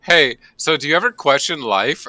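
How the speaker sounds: lightly voiced chirpy questioner; Lotus voice. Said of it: "lovely Lotus is like".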